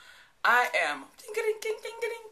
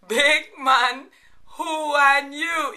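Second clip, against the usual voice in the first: loud, very slow call to repeat; teaching it slowly; 4 semitones higher, 8 dB louder, much slower than usual. Shown as a demonstration. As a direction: lead the viewers through it word by word. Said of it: "Say it with me".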